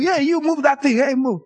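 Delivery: high-pitched imitation of a pushy driver; bossy calling out. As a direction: order them aside in a high voice. Retold driver ordering others from window.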